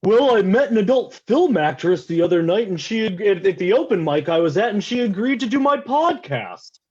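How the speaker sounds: mildly voiced podcast bro; overheard LA guy. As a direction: lightly voice a smug LA bro bragging. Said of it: "he goes".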